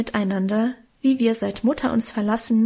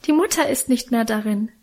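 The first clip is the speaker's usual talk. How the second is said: ordinary delivery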